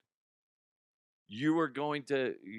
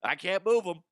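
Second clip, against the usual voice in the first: higher, pleading mock voice; voicing the squished dog; 7 semitones higher than usual. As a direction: voice the squashed dog begging for a hug. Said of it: "She's like".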